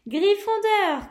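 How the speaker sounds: slow, high, loud proclamation; triumphant shout of the house; hat shouting. Acted. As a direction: draw out the house name for the hall. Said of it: "cria le chapeau".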